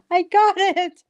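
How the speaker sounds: high-pitched mock scream of triumph; retold excited scream. Guest retelling her middle-of-the-night acceptance email, retold scream. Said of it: "and I screamed".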